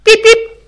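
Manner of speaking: very high loud bleep sound; censor bleep. Shown as a demonstration. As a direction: bleep out the swear word like a censor. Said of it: "вам скажу, что". (очень высокий громкий писк, как цензура)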